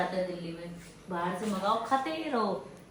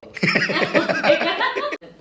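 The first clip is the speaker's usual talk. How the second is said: laughter; 8 semitones higher, 7 dB louder than usual. Real, not acted. Family laughing.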